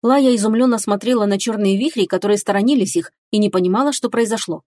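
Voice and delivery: ordinary delivery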